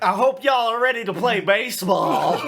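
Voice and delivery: loud, high, hammed-up Southern holler; over-the-top Southern announcer shout; Southern accent. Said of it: "He doesn't even have a southern accent".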